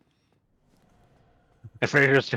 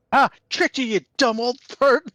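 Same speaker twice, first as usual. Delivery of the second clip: squeaky mocking prank caller, much higher; taunting in a high, pitched-up voice; 13 semitones higher, 4 dB louder than usual. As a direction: jeer it in a high kid's voice. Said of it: "my young-sounding voice".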